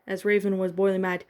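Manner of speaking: plain reading voice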